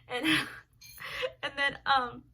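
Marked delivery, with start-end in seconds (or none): none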